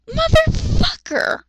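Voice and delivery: drawn-out exasperated curse, voice shooting high; exasperated outburst. Real, not acted. Reader yelling at the fanfic's author, drawn-out high-pitched curse. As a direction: yell it at the page in disbelief.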